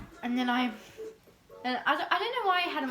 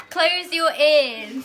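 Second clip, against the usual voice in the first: much louder, slow, laughing through the words; laughing joke; 4 semitones higher, 12 dB louder, much slower than usual. Real, not acted. Joking about spoilers, laughing.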